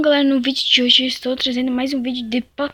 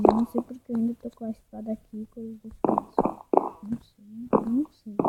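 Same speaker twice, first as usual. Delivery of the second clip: whispered muttering; 4 semitones lower, 8 dB quieter, faster than usual. Real, not acted. Confused muttering to self.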